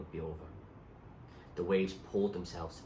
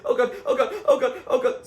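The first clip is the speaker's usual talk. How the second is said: panicked cry; 12 dB louder than usual. A narrator's reading. Boat swamped by wave.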